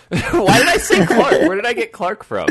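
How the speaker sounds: high, loud, laughing disbelief. Real, not acted. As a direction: crack up at your own slip of the tongue. Host cracks up at own slip.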